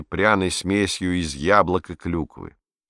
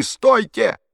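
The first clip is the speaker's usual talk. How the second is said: loud high shout to stop him; boy shouting to stop Hitler eating; 5 dB louder than usual. A narrator's reading. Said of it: "закричал Пьеро".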